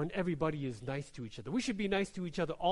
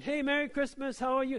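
bright, higher, friendly call; cheery greeting; 8 semitones higher, 4 dB louder than usual. Retold or imitated. Pastor greeting people on the stairs, cheerful. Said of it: "I'm saying".

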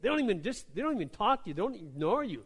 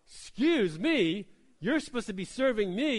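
indignant; 4 semitones higher, 7 dB louder than usual. Retold or imitated. Pastor voicing his offended reaction to the clerk, indignant. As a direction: play the offended customer demanding service. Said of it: "that's what I wanted to say".

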